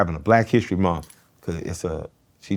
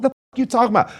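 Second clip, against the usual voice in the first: outraged; 6 dB louder, faster than usual. Retold or imitated. Retold outburst at grandma.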